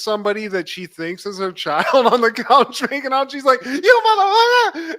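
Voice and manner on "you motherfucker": very high, loud laughing shriek; shocked scream. Retold or imitated. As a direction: shriek it in mock horror. Lisa shocked at the couch.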